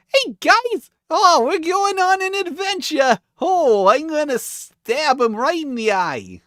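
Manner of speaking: squeaky, silly party member, eager to stab; goofy little dude. Retold or imitated. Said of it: "It's not like I was like".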